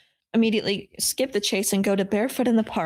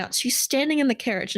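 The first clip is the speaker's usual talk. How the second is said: usual speaking voice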